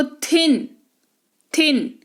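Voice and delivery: थिन with an aspirated थ at the start. Shown as a demonstration. Teacher showing another sound of T, थिन starting with aspirated थ. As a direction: say थिन twice, each opening on breathy-released th. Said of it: "फिर थ के तौर पर देखो".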